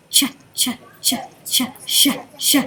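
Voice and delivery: voiceless ch, ch then sh, sh, no vowel; ch and sh sounds. Shown as a demonstration. Teacher to class, modelling ch turning to sh, unvoiced. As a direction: hiss out ch, ch, then switch to sh, sh. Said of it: "Let's try that".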